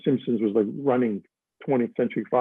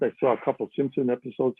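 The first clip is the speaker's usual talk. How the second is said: ordinary delivery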